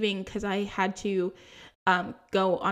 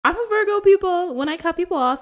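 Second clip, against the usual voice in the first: higher and louder, proud; proud declaration; 9 semitones higher, 7 dB louder, faster than usual. Real, not acted. Vlogger to viewers, proudly higher and louder. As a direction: announce it like a badge of honor.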